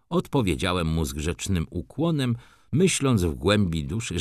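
ordinary delivery

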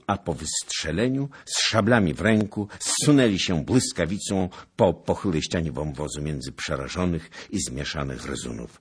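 usual speaking voice

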